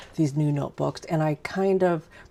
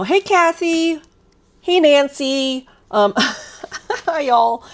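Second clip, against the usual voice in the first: excited greeting; 9 semitones higher, 6 dB louder, much slower than usual. Real, not acted. Greeting chat viewers by name.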